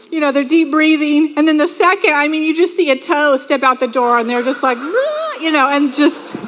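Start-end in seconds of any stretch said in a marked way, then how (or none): 4.8-5.4 gasp of awe. Retold or imitated. Groom sees the bride.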